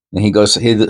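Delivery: usual speaking voice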